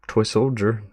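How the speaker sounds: ordinary talk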